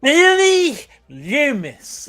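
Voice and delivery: dramatic movie voice. Acted. Podcaster redoes the killer's name in a dramatic voice. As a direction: stretch the name out like a horror trailer. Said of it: "Sorry, I got the voice".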